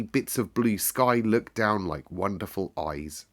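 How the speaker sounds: usual speaking voice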